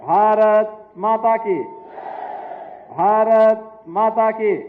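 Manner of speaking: rallying chant leader, words stretched out slowly; loud slogan call. Real, not acted. Speaker leading the crowd in slogans, loud drawn-out rallying call. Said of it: "इतने जोर से बोलेंगे".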